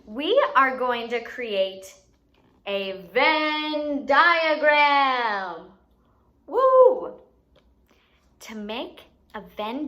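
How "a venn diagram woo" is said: slow drawn-out reveal ending in a loud high cheer; excited announcement. Acted. Teacher building excitement for the activity. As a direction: stretch it out like a big reveal.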